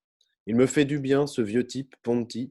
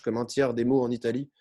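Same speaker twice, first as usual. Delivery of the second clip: usual speaking voice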